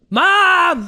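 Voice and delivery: loud, high call across the house; yelling for mom. Retold or imitated. Kid on the toilet yelling for his deaf mom, loud high call. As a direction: holler for mom from the bathroom.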